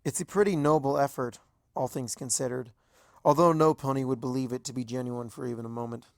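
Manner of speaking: usual speaking voice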